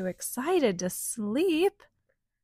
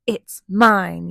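louder, possessive yell; 9 dB louder than usual. Acted. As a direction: yell it back, claiming it. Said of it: "yelled the other".

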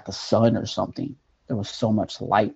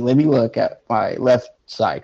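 ordinary delivery

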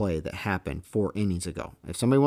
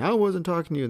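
high, louder indignant outburst; retold angry protest; 11 semitones higher, 5 dB louder than usual. Head coach yelling back at the umpire, retold angry protest. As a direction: imitate an irate coach protesting.

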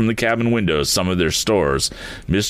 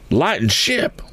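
calling out; 10 semitones higher, slower than usual. A narrator's reading. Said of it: "sung out Mister Sharp".

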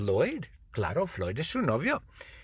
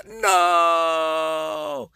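long drawn-out cry of refusal; grito narrado; 4 semitones higher, 4 dB louder than usual. A narrator's reading. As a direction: shout it as one stretched, sustained wail. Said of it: "Y Judge Judy grita". (grito largo y sostenido)

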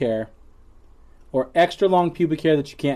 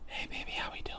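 whispered creepy come-on; 21 dB quieter than usual. Acted. Co-host acts out walking up to someone creepily, whispering. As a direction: drop to a soft, voiceless whisper, like a creep sidling up. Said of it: "walk up and whisper".

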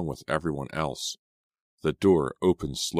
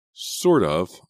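usual speaking voice

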